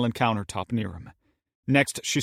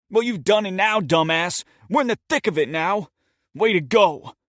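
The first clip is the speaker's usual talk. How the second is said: loud, high, sneering rebuke; a furious sarcastic scolding; angry scolding; 9 semitones higher, 6 dB louder than usual. Acted. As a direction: tear into your partner with contempt. Steven berates his partner.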